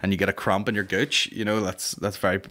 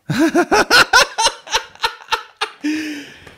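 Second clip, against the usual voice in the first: loud high real laugh; real cackle; burst of high laughter; 7 dB louder than usual. Real, not acted. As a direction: crack up loudly at the screen. Laughing at cockatiel search.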